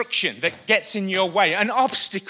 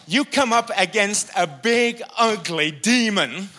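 rising, loud, higher preacher's build; fiery preaching; 6 semitones higher, 7 dB louder than usual. Acted. Preaching builds to full force.

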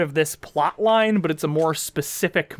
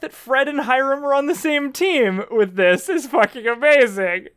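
excited; 8 semitones higher than usual. Real, not acted. Gleeful recap of absurd plot.

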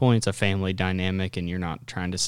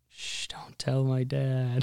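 slow, unvoiced pleading whisper; hush, beg him to keep the secret; 4 semitones higher, 4 dB quieter, slower than usual. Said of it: "Joe just looks at Shay and just like".

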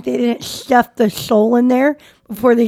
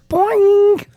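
much higher, springy boing sound; cartoon boing; 10 semitones higher than usual. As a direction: pop it out like cartoon eyes. Cartoon eyeballs bulging out.

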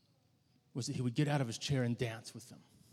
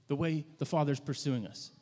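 ordinary talk

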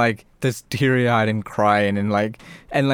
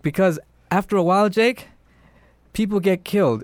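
higher-pitched doll giving a lesson; persuading killer doll; 7 semitones higher than usual. Retold or imitated. Chucky explaining death to Jake, persuasive. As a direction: play Chucky reasoning with the boy.

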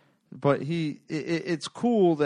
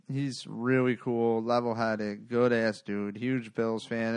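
ordinary talk, nothing marked

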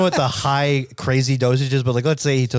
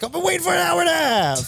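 high shrieking complaint, much higher; whiny Chris. Retold or imitated. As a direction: wail it like a frazzled mobster. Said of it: "Chris makes his way to a payphone and he's like".

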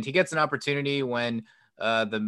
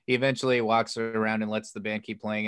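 ordinary talk, nothing marked

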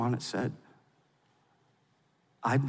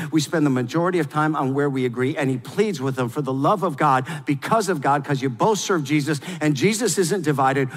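usual speaking voice

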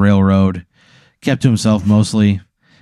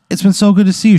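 happy reunion; 11 semitones higher, 4 dB louder, faster than usual. Acted. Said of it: "She goes".

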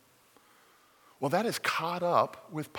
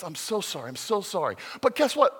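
ordinary talk

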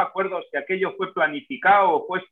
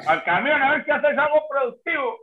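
a mildly voiced father, ordering his kid into the van; lightly hammed-up dad rounding up the boy; bossy dad; 10 semitones higher than usual. Father telling his bored son to hop in the truck, mildly mock bossy.

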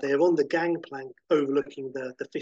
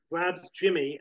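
ordinary delivery

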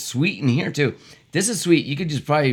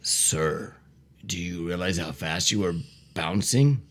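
deep officer voice; 11 semitones lower, 7 dB quieter than usual. Acted. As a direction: play the stern traffic cop low. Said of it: "Do different voices".

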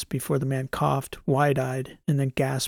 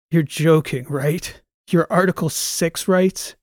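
scornful disbelief; incredulous scoff; 3 semitones higher, 5 dB louder, slower than usual. Acted. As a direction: laugh off his demand. Transom scoffs at prisoner.

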